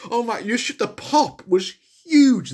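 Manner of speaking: high and slow, still elated; breathless excitement. Real, not acted. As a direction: still riding the thrill. Still buzzing after cheer.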